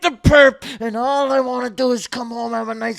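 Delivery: over-the-top screaming match; screaming rage. Acted. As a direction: scream it at your wife, all control gone. Acted marital screaming match.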